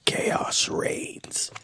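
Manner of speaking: slow, ominous whisper; whispered fox quote. Acted. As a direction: whisper it as the fox's eerie pronouncement. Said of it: "he says those two words".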